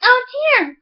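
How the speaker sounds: high startled cry; a sudden high "Oh dear!" of panic; cry of alarm. Acted. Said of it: "cried the woman".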